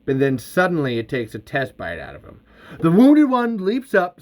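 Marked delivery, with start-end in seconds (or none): 2.8-4.2 excited. A narrator's reading. Narrator builds the creature's leap.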